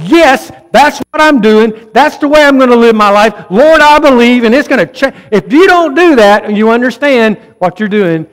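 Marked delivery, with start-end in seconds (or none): 0.1-5.1 fervent vow. Acted. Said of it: "if you just don't go".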